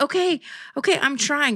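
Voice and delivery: high-pitched defensive retort; exasperated protest. Retold or imitated. Customer retelling her answer to the nail tech, exasperated.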